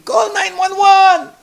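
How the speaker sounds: high, loud, slow panicked scream. Pretend. Diner screaming for help as people drop dead, high and loud. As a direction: shriek for help.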